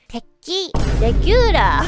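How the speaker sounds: confident order, high then loud; re-enacted cocksure order; so confidently. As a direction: announce it boldly, syllable by syllable. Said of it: "I said it so confidently".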